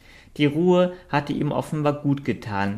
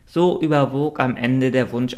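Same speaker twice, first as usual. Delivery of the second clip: ordinary delivery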